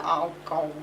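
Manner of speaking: low, dismissive sigh of a celebrity; weary groan. Retold or imitated. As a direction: let it sink like disappointment. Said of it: "she just went".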